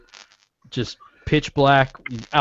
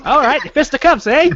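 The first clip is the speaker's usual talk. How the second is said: put-on high, puffed-up old-timey prizefighter challenge; old English boxer; 11 semitones higher, 5 dB louder than usual. Man rolls sleeves up to fight the delivery guy, old English boxer voice. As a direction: square up like a Victorian boxer. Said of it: "like one of those old English boxers".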